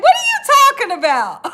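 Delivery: high, loud, fast indignant retort. Retold or imitated. Snapping back at her husband on the phone, indignant. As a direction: fire it back in disbelief.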